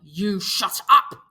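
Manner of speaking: loud, slow, menacing shout; angry snap. Acted. Jack turning on Piggy, slow angry shout. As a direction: bite every word. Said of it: "Jack turned on him".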